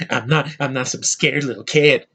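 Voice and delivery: a Brit's clumsy American accent, imitated; mock the British actor's fake American accent; bad American accent. Reviewer mocking lead's bad American accent. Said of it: "Brits doing these shitty accents".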